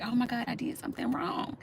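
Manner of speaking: unvoiced, hushed little gasp of guilt; scared whisper. Child sensing father's disapproving look, whispered in guilty panic. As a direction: whisper it like a child who knows she's in trouble.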